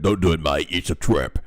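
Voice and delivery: low gruff orc warning. Acted. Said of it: "be read in an orc voice".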